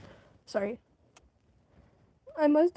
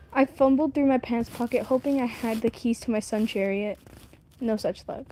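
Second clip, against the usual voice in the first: ordinary delivery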